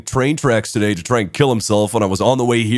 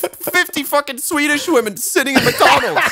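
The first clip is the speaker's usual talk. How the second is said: high, breaking into real laughter; punchline squeezed out through rising laughter; laughing disbelief; 12 semitones higher than usual. Real, not acted. Storyteller reveals fifty women at McDonald's, laughing incredulously.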